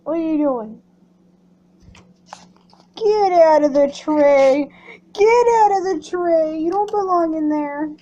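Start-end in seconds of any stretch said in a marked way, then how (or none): 3.0-7.9 loud scolding. Real, not acted. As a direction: tell the bird off firmly. Scolding the bird, louder.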